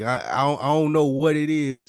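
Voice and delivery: usual speaking voice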